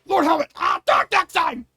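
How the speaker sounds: very high, loud mock shout; Spaceballs parody. Aide bursting in on Lord Helmet, high mock shout. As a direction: squawk it in panic. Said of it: "a Spaceballs scene".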